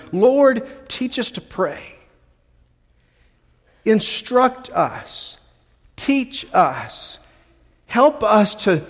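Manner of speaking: prayerful plea. Acted. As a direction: plead gently to the Lord. Prays as the disciples.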